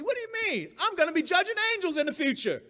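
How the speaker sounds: hammed-up smug comeback; mock haughty reply. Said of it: "the reply might be".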